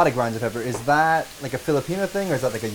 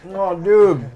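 high, loud exclamation through a mouthful; excited, mouth full; 8 semitones higher, 10 dB louder than usual. Real, not acted. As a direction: burst out delighted with food in mouth. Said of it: "talking into the radio with my mouth full".

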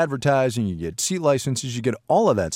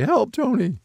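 high-pitched plea for help; playful, squeaky cry for rescue; mock call for help; 13 semitones higher, slower than usual. Shown as a demonstration. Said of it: "it's gonna sound like this when we say".

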